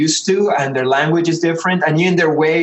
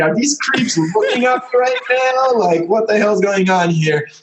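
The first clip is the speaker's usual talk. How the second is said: paranoid inner voice; 6 semitones higher than usual. Retold or imitated.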